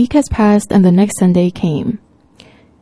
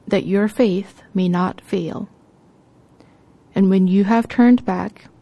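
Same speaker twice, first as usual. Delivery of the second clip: scripture reading. Acted. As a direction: read the Gospel reverently.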